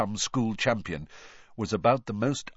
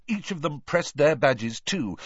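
usual speaking voice

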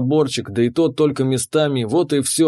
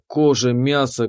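ordinary delivery

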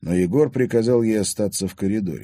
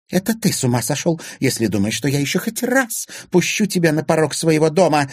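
high, angry screech growing louder; shrieking; 10 semitones higher, 4 dB louder than usual. A narrator's reading. Anfisa banning Timofey from her house, shrieking. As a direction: screech it as a threat. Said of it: "визжала Анфиса".

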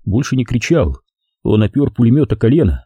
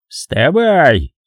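barked; 5 semitones higher than usual. A narrator's reading. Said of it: "рявкнул фельдфебель".

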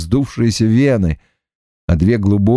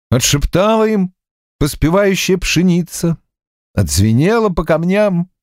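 lyrical, mournful narration, rising higher; elegiac lament; 5 semitones higher than usual. A narrator's reading. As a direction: sing it out like a farewell.

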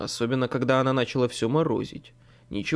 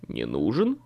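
put-on lower, puzzled young man; surprised young man; 9 semitones lower than usual. A narrator's reading. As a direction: asks it low, taken aback. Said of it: "удивился Билли".

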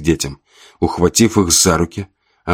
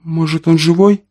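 desperate hope; 11 semitones higher than usual. A narrator's reading. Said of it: "промелькнула шальная мысль".

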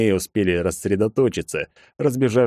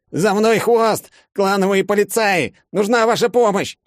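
panicked shout; 13 semitones higher, 4 dB louder than usual. Acted. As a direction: yell while fleeing pursuers. Nari fleeing, calls for help.

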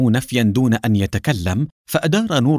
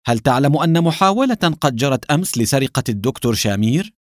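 plain reading voice

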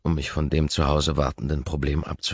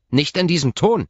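higher, louder snapped rebuke; hissing nurse; 13 semitones higher, 7 dB louder than usual. Acted. Nurse snapping at the angry visitor. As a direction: snap back at his tone. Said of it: "faucht sie mich an".

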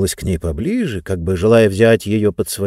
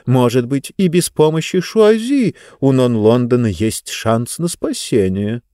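ordinary delivery, nothing marked

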